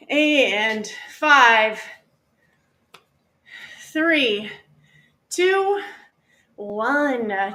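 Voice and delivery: hoarse hyped-up workout cheer; hoarse marathon cheering. Acted. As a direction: drive them through the last seconds. Final push of the workout.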